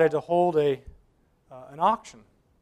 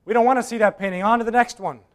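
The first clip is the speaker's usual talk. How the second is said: higher, louder protest; crying out; 6 semitones higher, 7 dB louder than usual. Acted. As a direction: call out, dismissive. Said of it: "cries out and says".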